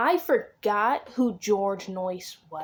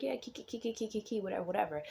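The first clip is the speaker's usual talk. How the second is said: mumbled gibberish; 9 dB quieter, faster than usual. Retold or imitated. Quoting Chuck's French as gibberish.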